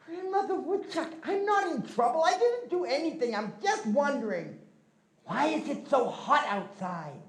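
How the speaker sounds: put-on young Glooskap. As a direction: play the cheeky boy feigning innocence. Glooskap pleads innocence to grandmother.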